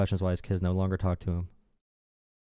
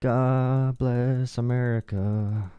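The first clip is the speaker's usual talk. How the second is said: drawn out; much slower than usual. Pretend. Patriotic flourish.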